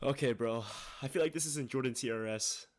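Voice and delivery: ordinary talk, nothing marked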